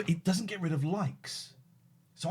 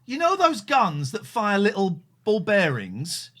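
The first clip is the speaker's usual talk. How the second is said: slow, loud, deliberate explanation; slow explaining; 3 semitones higher, 4 dB louder, slower than usual. Real, not acted. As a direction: spell it out slowly for the caller. Slowly explaining to a friend.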